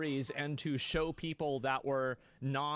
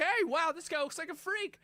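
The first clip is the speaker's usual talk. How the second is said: mock gawking viewer; 12 semitones higher than usual. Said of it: "everybody's like".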